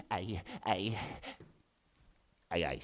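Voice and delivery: plain reading voice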